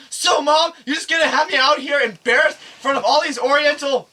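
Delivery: high, loud rant at mom; furious yelling. Retold or imitated. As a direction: act out a boy blowing up at his mother. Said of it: "I never yelled at my mom so loud".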